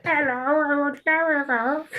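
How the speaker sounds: very high, slow voice changer squeak; squeaky chipmunk voice; helium voice. Pretend. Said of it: "that sounds like helium".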